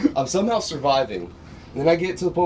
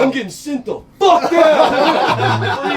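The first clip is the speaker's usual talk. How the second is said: much higher, louder, slow, laughing mock panic; panicked yell; 13 semitones higher, 7 dB louder, much slower than usual. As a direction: wail it out, half laughing. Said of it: "I'm like".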